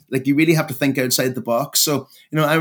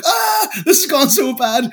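mock despair; 13 semitones higher, 4 dB louder, slower than usual. Retold dismay at few views.

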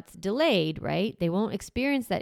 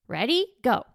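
upbeat start signal; 5 semitones higher, 5 dB louder than usual. Shown as a demonstration. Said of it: "This is my example".

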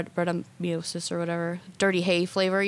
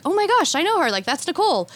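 excited recognition; 9 semitones higher, 3 dB louder than usual. Retold or imitated. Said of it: "their oldest son was like".

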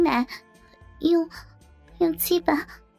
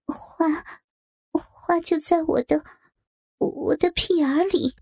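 higher, halting, trembling character voice; 6 semitones higher than usual. A narrator's reading. A coerced bridesmaid recites to the group, trembling. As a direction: falter as if forced to recite. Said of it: "颤颤地说".

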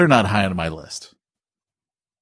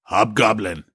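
put-on goblin call; hammed-up monster voice; mock spooky voice; 2 semitones lower, slower than usual. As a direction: call it out like a playful bogeyman. Mock line to scare child.

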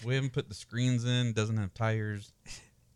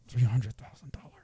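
hushed whisper at the price; awed whisper; 6 dB louder than usual. Real, not acted. Reviewer hushing the shocking price to his co-host, whispered. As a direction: breathe the price out in disbelief.

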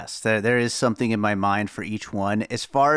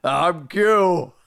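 repeating the voice; 6 semitones higher, 4 dB louder than usual. Retold or imitated. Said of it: "your impression of what my voice sounds like".

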